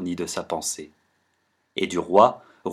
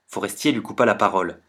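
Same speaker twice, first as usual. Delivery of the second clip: usual speaking voice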